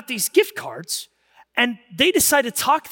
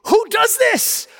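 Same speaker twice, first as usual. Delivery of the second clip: much higher, slow astonished cry; amazed disbelief; 14 semitones higher, 5 dB louder, slower than usual. Retold or imitated. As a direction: gasp it out in wonder. Retold amazed reaction.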